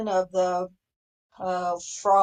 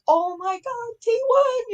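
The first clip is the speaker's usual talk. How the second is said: excited squeal; 13 semitones higher, 3 dB louder than usual. Retold or imitated. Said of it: "we were like".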